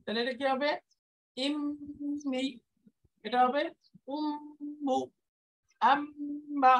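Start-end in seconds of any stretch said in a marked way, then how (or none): 1.4-2.4 ইমনি with a long, high, held nasal m; slow nasal ইমনি; held ghunnah. Shown as a demonstration. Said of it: "এটাকেই গুণনা বলে". 4.2-5.0 উম্মু with the doubled m hummed long; nasal hold in উম্মু; held ghunnah. Shown as a demonstration. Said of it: "এটাকেই গুণনা বলে".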